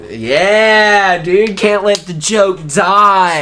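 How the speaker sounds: high, loud, drawn-out hype; hyped-up yell. Pretend. Keeping the sponsor joke alive, in a hammed-up yell. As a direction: belt it out slowly and big.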